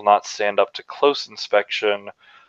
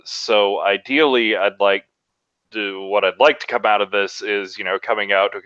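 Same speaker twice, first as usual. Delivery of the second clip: ordinary talk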